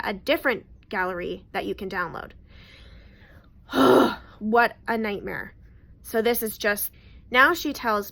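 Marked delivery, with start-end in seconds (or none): 3.7-4.3 heavy sigh, louder than her talk; loud exasperated sigh. Real, not acted. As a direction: let out a big fed-up sigh. Exasperated after telling the story.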